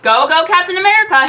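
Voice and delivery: loud high rallying cry; loud cheering hero cry; shouted. Acted. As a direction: shout it like a chant. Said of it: "he shouted".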